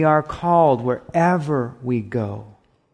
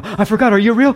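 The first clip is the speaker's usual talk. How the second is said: mock forgetful question; 8 semitones higher, 6 dB louder, faster than usual. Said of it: "you're walking going".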